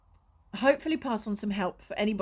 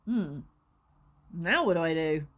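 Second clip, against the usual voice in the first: lower, flat puzzled comedown; deflated; 6 semitones lower than usual. Retold or imitated. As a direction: drop from triumph to a lost shrug. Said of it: "you go from this".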